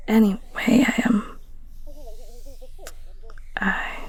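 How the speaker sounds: gentle caring whisper to a sick partner; tender bedside whisper, unhurried; soft ASMR whisper. Acted. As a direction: whisper close and soothing.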